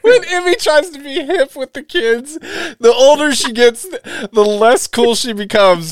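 very high, loud, squeaky rant; hysterical teasing. Real, not acted. Host roasting co-host for trying to be hip, squealing.

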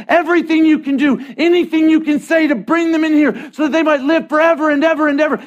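high, loud, driving commission; impassioned shouted rallying call; urgent exhortation. Acted. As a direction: send them out with full-force preaching. Jesus urgently sending believer out.

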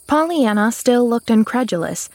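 plain reading voice

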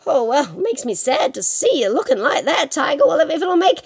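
high, quick, reluctant-sounding consent; falsely sympathetic rabbit. Acted. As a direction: give in with pretend pity. Plays Rabbit feigning reluctance.

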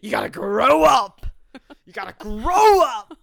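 loud stern shout; mock father yelling at his son; shouting dad. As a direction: yell it like a scolding father.

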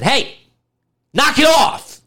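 high, loud scolding shout; stern teacher yell. Retold or imitated. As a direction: snap at the kid like a strict teacher. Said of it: "the teacher backhanded him ... and said".

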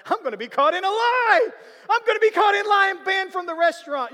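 high, loud mock panic; frantic yelled inner voice; panicked shout. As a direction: blurt it out in alarm at being caught. Panicked inner thought, caught lying.

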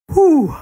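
high pained whoosh of breath; sharp exhale. Real, not acted. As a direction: blow out hard through the lips. Burning from the hot chip.

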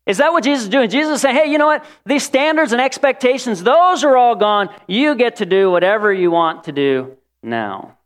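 high, loud sarcastic take; mock a lax message; mocking sarcasm. Mocking lax Jesus idea.

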